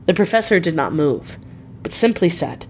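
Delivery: ordinary delivery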